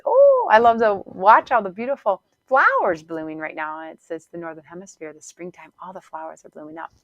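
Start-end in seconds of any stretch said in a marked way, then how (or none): 0.0-3.9 lifted, louder dreamy delight; gushing. Real, not acted. Coach gushing about spring flowers, higher and louder. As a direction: swoon over the flowers.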